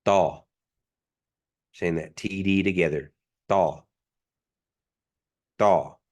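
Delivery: Daw with a tight t-like d; bracketed D. Shown as a demonstration. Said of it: "The bracketed D".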